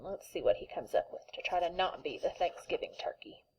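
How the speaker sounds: hushed, breathy storyteller whisper; suspense whisper. Acted. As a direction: whisper it like a secret to the class. Teacher builds suspense for kids.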